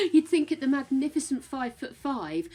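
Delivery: amused, laughing at yourself. Real, not acted. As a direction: talk through your laughter. Laughing at their own height.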